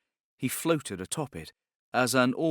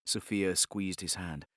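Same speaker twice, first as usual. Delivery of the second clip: usual speaking voice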